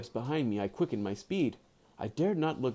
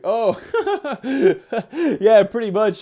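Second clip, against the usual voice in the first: high, loud laughing reply; loud laughing agreement; burst of laughter; 7 semitones higher, 9 dB louder than usual. Real, not acted. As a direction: crack up at the joke. Laughing at chat comment.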